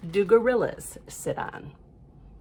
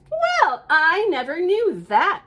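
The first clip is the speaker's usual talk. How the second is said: high, louder astonished frog voice; amazed frog; 6 semitones higher, 6 dB louder, slower than usual. Acted. Frog marvels at the cat's answer in a high voice. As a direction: marvel at the news like a wide-eyed frog.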